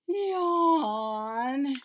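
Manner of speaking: big stretched-out yawn; sleepy yawn. Pretend. Reads yawn in bedtime book.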